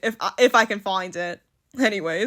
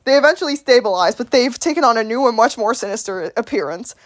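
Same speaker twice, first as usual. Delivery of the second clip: usual speaking voice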